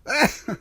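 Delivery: high scoffing laugh; short high laugh at the superstition; amused laugh. Acted. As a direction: laugh off the warning. Mocking waitress's superstition.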